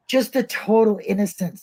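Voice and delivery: normal talking voice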